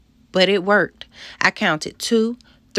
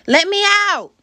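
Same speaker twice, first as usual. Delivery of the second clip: high, loud screamed plea; desperate high-pitched yell; panicked scream; 6 dB louder than usual. A narrator's reading. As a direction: scream to be freed, trapped in a trunk. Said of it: "I screamed".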